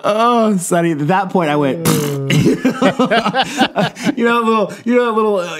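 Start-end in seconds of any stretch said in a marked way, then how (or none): 1.8-4.1 snorting horse-like laugh burst out; real snorting laugh, high and loud; involuntary horse laugh. Real, not acted. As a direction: laugh like a whinnying horse you can't stop. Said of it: "a little involuntary horse sound".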